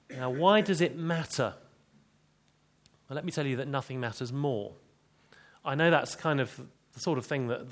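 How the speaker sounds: ordinary delivery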